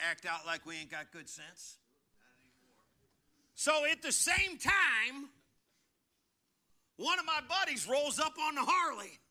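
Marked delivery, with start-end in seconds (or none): 3.5-9.2 louder, higher, slow preacher's build; full-force preaching. Acted. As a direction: preach it out big to the congregation.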